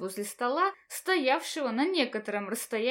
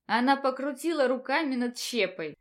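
ordinary delivery

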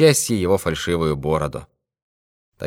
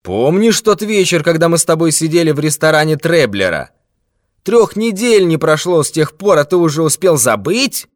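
a lightly voiced threatening villain, higher and pressing; menacing thief; 6 semitones higher, 3 dB louder than usual. Ginger thief presses old miser, menacing. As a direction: lean on him with contempt.

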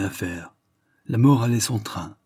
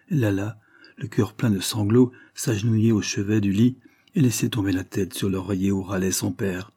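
usual speaking voice

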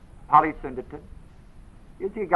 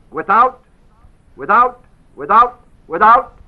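each WITHOUT punched louder; emphatic without; 4 semitones higher, 9 dB louder than usual. Shown as a demonstration. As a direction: hammer the word without, again and again. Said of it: "That's how it's said".

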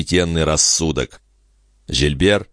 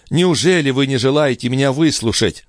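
higher-pitched urgent protest; desperate, raised appeal; crying out; 7 semitones higher than usual. A narrator's reading. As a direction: plead to be heard. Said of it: "вскричал Жильбер".

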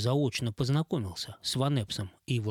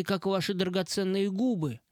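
breathy flatterer; 8 semitones higher than usual. Acted. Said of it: "придыхательным стилем общения".

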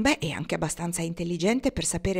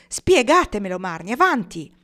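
loud, insistent, raised at first; forceful demand; 3 semitones higher, 9 dB louder than usual. A narrator's reading. Pocket presses Marnie to explain, forcefully. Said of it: "chiese lui con forza".

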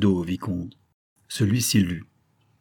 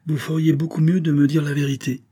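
higher, heated demand; irritated, raised-pitch insistence; sudden outburst; 6 semitones higher, 3 dB louder than usual. A narrator's reading. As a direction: press for an answer with sudden heat. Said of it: "avec un emportement subi".

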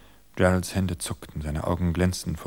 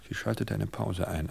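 plain reading voice

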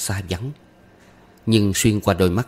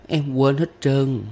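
put-on high, slow child voice; young pupil's drawn-out high voice; forgetful little girl; 6 semitones higher, slower than usual. A narrator's reading. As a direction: confess slowly as a small girl. Child admits she forgot the song.

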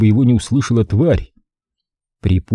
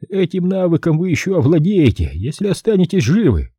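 higher dry handler voice; leaking from hidden earpiece; 8 semitones higher than usual. Acted. Crowley replies through earpiece.

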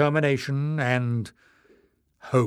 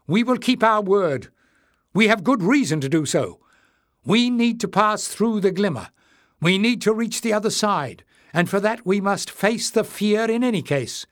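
high, loud, urgent pleading; desperate shout; 9 semitones higher, 4 dB louder than usual. A narrator's reading. Jasmine pleading with the leader to trust them, high and urgent. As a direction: press the case desperately. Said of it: "desperate to undo the damage".

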